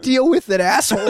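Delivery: high snide retort. Retold or imitated. To his friend about garlic breath, a high snide retort. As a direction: throw it back with contempt.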